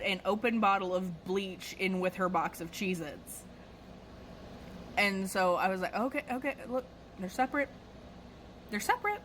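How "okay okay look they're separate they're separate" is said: high, quick, softened reassurance; retold appeasing, voice lifting up; anxious placating. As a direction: placate an angry customer in a raised pitch. Placating angry customer, showing items.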